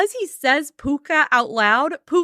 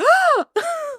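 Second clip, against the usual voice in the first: sharp high mock gasp; a sudden high intake of breath; shocked gasp. Eli hearing the dog's name, high shocked gasp. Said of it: "And Eli is like".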